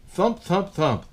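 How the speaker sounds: plain reading voice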